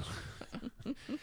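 high airy giggle; a quick breathy laugh. Real, not acted. Nervous laugh at trauma joke.

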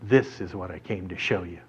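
low, soft daredevil declaration; solemn announcement. Acted. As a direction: drop low and quiet, deadly serious. Daredevil's solemn announcement after pushing bike up.

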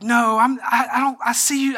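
imitating a defensive husband. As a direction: protest a little too hard. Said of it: "I could have fought her on that".

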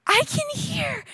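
high, thrilled cry of someone healed; a high, amazed shout of joy; excited cry. Retold or imitated. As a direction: squeal it out in sudden joy. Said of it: "all you can hear is".

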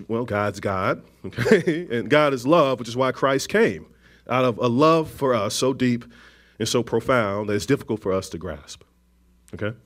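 ordinary delivery

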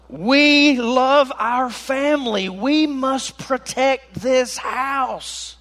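loud, high, drawn-out declaration; hammed-up parental plea. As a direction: proclaim it slowly like a dramatic parent. Voices the distressed parents' plea.

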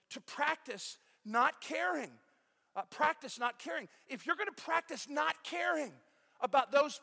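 loud, rising preacher's exhortation; full-force preaching. Acted. Pastor urging his church to practice not caring, preaching full force. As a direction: drive it home to the congregation.

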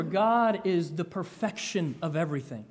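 normal talking voice

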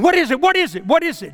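high-pitched repeated demand to know; retold panic, firing out the question; mock-frantic, pitched well up; alarmed questioning. Retold reaction to hysterical wife.

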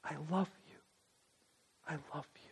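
barely above a breath, close and tender; softly in the ear. Pretend. God speaking close to your ear, very soft. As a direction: murmur it right into their ear. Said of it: "let my mouth come close to your ear and say to you".

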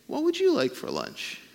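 lifted, bright caring question; kindly asking a child; mother offering lunch. Retold or imitated. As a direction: offer lunch like a doting mom. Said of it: "she said".

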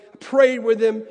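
usual speaking voice

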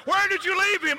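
frantic, almost shouting at her husband; frantic wife. Retold or imitated. Wife demanding where the husband left their son, frantic. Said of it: "She's freaking out".

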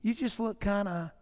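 quietly hammed-up concern; Andy's teasing question replayed; mock concern. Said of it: "looking at him and saying".

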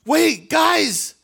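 sudden high, loud cry to the crowd; excited call. Retold or imitated. John the Baptist pointing out Jesus to the crowd, excited. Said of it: "he says".

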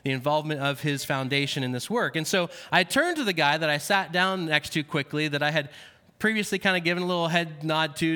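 usual speaking voice

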